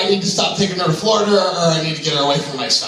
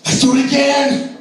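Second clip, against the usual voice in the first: put-on much higher, louder, thrilled child's voice; excited kid; 11 semitones higher, 3 dB louder than usual. Son to dad after the ride, imitated excited kid. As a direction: gush like a kid off a roller coaster. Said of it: "he's like".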